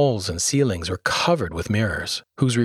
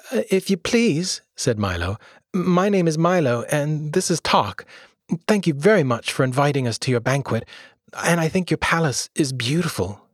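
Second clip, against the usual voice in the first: put-on young boy voice; 6 semitones higher than usual. Young Milo addresses the king.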